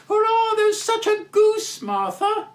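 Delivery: put-on very high, loud excited kids; children cheering. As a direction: cheer like thrilled small children. Young Cratchits cheer.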